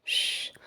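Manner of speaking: soothing shush. Acted. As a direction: hush her gently, like calming a child. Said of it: "ಸಮಾಧಾನಿಸುತ್ತಾ".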